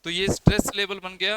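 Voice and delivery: normal talking voice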